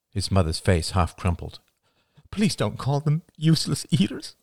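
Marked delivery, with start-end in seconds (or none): 2.3-4.4 much higher, earnest pleading mother; mother urging forgiveness. A narrator's reading. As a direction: beg for mercy for family. Mother voiced high.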